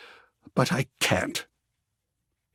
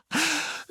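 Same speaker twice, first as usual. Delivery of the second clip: light laugh; 12 semitones higher, 4 dB quieter than usual. Acted. Artist's bashful laugh.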